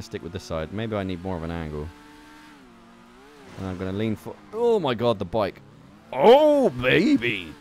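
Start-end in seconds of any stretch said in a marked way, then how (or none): 6.1-7.5 excited cheer. Real, not acted. Gamer thrilled by the bike, loud excited cheer. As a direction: cheer the landing at full voice. Lands a stunt in game.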